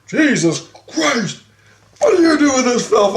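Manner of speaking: high, indignant disbelief; scandalized outcry. Retold or imitated. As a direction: imitate someone aghast and telling off. Christ scandalized by the magazine.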